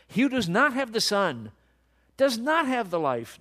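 high, loud, emphatic preaching; worked up. Real, not acted. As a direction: drive each phrase home, worked up. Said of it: "I'm getting worked up about this".

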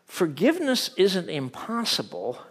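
ordinary talk, nothing marked

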